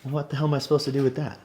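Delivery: overwhelmed by his son's words; overwhelmed disbelief. Real, not acted.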